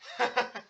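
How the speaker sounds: short, high, soft laugh; amused chuckle. Real, not acted. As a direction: chuckle at what was just read. Reader laughs after the story.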